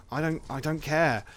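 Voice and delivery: mock dismissive. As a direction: imitate someone refusing to be bothered. Luke refusing Rey's question.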